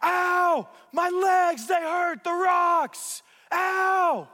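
high, loud pained yelling; retold scream. Friend in the river screaming in pain, high loud yell. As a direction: scream it out like rocks are cutting your legs. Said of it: "literally, he screams".

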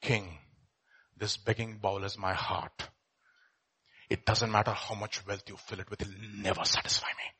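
low, soft, near-whispered reply; hushed reveal. Acted. Beggar answering the emperor in a soft low whisper. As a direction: let the answer fall softly, almost a whisper.

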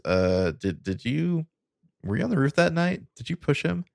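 halting, high-pitched imitated questioning; thoughtful, hesitant. Allison asks Mona about that night, hesitant. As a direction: feel your way toward a delicate accusation. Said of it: "Allison kinda thinks about that".

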